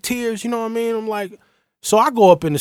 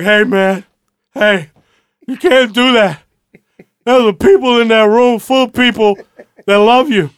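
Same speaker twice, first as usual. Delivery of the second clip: put-on higher, louder drunk pleading; drunk friend; 7 semitones higher, 6 dB louder than usual. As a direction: lecture your buddy like a drunk friend. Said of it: "he's drunk off his ass".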